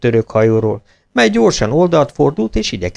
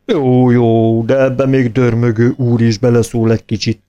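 grumbling; 4 dB louder than usual. A narrator's reading.

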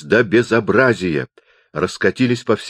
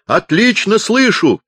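very high, drawn-out shout; 13 semitones higher, 5 dB louder, slower than usual. A narrator's reading. Deaf chaplain reports he can hear now, shouting. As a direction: holler it slowly and proudly. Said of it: "заорал капеллан".

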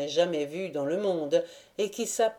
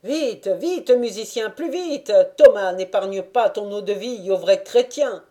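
shouting; 3 semitones higher, 6 dB louder than usual. A narrator's reading. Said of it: "Il ne cessait de crier".

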